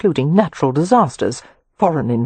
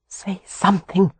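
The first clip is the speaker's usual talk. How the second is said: desperate thought; 3 semitones higher than usual. A narrator's reading. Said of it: "she thought desperately".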